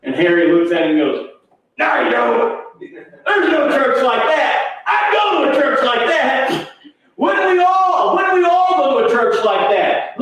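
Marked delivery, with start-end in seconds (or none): 1.8-10.2 high, drawn-out preacher's shout; impassioned challenge. Retold or imitated. Harry answers the preacher, retold as a high impassioned shout. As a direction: cry it out with mounting conviction.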